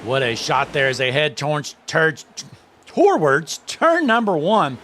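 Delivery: talking through a mouthful; mouth full. Real, not acted. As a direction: stumble over words with food in mouth. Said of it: "Sorry, I had something in my mouth there".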